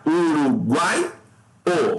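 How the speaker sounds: Uruguai, o stretched slowly, guai rising; syllable by syllable. Shown as a demonstration. Teacher splits uruguaio for students, slowly by syllable. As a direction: draw out Uruguai, then set o apart. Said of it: "mesmo procedimento".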